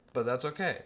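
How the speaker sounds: ordinary talk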